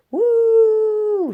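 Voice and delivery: high, loud joyful woo; excited whoop. Acted. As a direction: whoop with the thrill of speed. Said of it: "she whooped".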